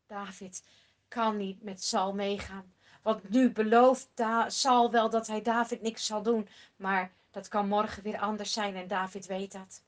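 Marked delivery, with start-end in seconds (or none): none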